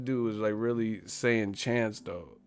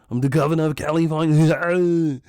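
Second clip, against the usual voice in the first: put-on loud, higher Austrian accent; Arnold impression; 6 semitones higher, 6 dB louder than usual. Arnold as governor, loud. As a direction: proclaim it like Schwarzenegger. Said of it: "My man came from Austria".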